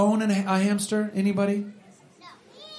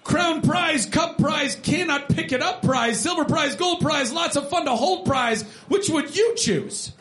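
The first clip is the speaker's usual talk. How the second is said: put-on reading to children; 7 semitones higher than usual. Reading picture book aloud.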